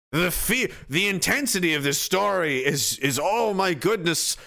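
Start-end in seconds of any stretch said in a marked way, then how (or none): none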